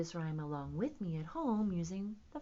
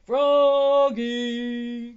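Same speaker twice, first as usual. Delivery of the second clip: loud, stretched-out holler; raised voice, long held vowels; calling out; 5 semitones higher, 18 dB louder than usual. A narrator's reading. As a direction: call the name across a distance. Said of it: "called Mister Mugwort".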